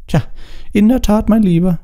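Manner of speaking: high trilling fat lady; the Fat Lady trilling to Harry. A narrator's reading. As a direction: sing it out sweetly, very high. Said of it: "trällerte sie".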